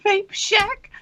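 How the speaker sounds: high, hyped jingle voice; sing-song ad hype. Acted. Jingle voice for Vape Shack.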